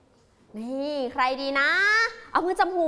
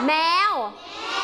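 very slow sounding out; much slower than usual. Shown as a demonstration. Teacher sounding out แมว slowly for the class. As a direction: stretch แมว แมว out very slowly, syllable by syllable. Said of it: "เอาเสียงค่ะ".

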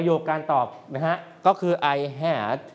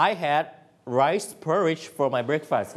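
slow repeat; much slower than usual. Shown as a demonstration. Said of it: "ก็คือ".

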